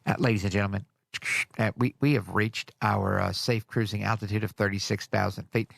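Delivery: a hint of a halting cabin announcement, low; pilot announcement. Woman pilot telling passengers the cruising altitude, lightly imitated announcement. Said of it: "she said".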